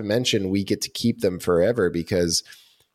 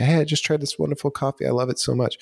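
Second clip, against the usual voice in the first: higher, quicker mock fan post; gushing customer; 7 semitones higher, faster than usual. Happy customer telling friends about coffee, gushing. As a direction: gush like a delighted customer. Voices an enthusiastic customer online.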